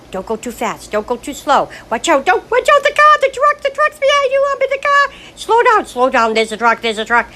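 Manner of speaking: rising to a high, loud panicked shriek; frantic passenger rising into screeching alarm; panicky backseat driver. Retold or imitated. Mom warning her daughter at the wheel, panicked shriek. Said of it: "I'll be like".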